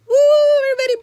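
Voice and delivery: very loud, high cheer; excited whoop. Real, not acted. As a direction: whoop to rally everybody. Cheers for a front liner.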